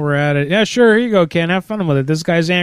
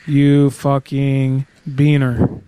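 reading as he types; slower than usual. Acted. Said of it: "Let me write that".

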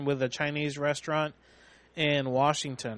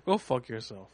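lower, softer, matter-of-fact imitation; casually lowered, understated put-down; imagined normal voice; 4 semitones lower, 5 dB quieter than usual. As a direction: mimic him dropping into an everyday voice. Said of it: "in a normal voice".

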